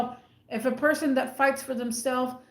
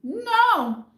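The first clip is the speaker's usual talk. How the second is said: a loud, high, outraged no; sharp loud no; indignant no; 10 semitones higher, 5 dB louder than usual. Real, not acted. Indignant retort.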